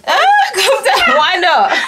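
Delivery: high laughing protest. Real, not acted. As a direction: laugh it out, surprised. Mother laughs at daughter's no.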